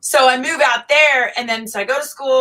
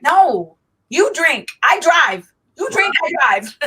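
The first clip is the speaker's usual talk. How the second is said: high, emphatic retold insistence; playful insistence; 6 semitones higher, 3 dB louder, slower than usual. Designated driver to drinking friends, playfully insistent. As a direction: insist in short, pitched-up bursts.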